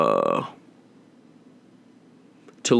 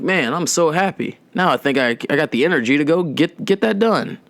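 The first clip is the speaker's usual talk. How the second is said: higher, lightly cheery mock voice; mock cheerful calm; 6 semitones higher than usual. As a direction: say it breezily like it's nothing. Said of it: "I'm like".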